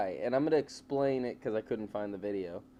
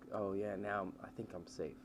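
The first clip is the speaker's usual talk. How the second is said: muttering to himself; 4 semitones lower, 11 dB quieter than usual. Retold or imitated. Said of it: "talking to himself".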